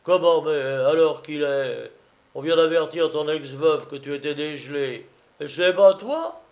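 outraged drum major, loud at the outset; angry accusation. Acted. Major threatening the false old comrade, angry and forceful. Major furious at being fooled.

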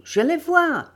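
loud, high-pitched excited cry; sudden exclamation. Acted. Dario, running ahead, spots them on the shore, exclaiming. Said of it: "s'exclama tout à coup".

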